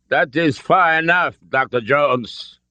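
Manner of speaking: put-on high, forceful German-accented command; thick German accent. A narrator's reading. Nazi colonel halts Doctor Jones, thick German accent. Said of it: "his thick accent".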